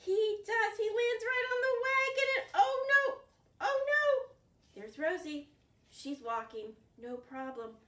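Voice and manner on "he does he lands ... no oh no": high, loud oh no; excited alarm. Pretend. Reader telling kids the fox crashed, high loud alarm. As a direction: dramatize the mishap for kids.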